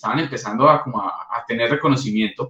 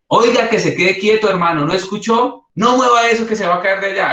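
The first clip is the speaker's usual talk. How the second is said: higher, louder stern telling-off; angry scolding; 6 semitones higher, 3 dB louder than usual. Shown as a demonstration. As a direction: lose your temper with the kid on the chair. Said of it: "el tono de voz cambió". (regaño fuerte al niño, con mal genio)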